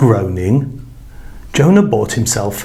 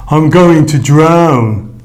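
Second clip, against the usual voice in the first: very slow, louder groaning lament; 3 semitones higher, 4 dB louder, slower than usual. A narrator's reading. Jonah sinking in the sea, slowly groaning. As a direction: drag it out with a groan. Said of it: "groaned Jonah".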